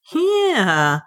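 high, drawn-out amused yeah; chuckling. A narrator's reading. Mick agreeing with Ralph about the frozen warriors, chuckling.

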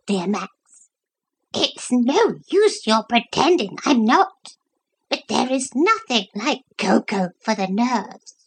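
high, fussy little dog voice; a wheedling lapdog fishing for cocoa; Brenda the dog. A narrator's reading. Brenda the dog begging Lucy for cocoa, high and fussy.

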